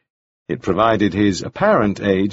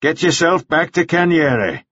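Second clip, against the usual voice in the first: higher, slower curt dismissal; dismissive Mannering; 7 semitones higher than usual. A narrator's reading. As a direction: send him off.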